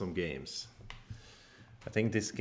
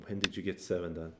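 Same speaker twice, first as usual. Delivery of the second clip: normal talking voice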